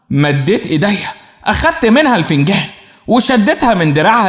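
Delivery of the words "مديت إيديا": ordinary delivery, nothing marked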